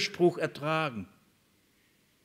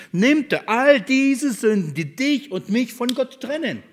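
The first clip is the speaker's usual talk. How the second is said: higher, building preacher's voice over the cross; rising sermon fervour; 6 semitones higher than usual. Acted. Preacher tells the congregation Jesus bore their sins, higher and building. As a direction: lift the pitch as the sermon swells.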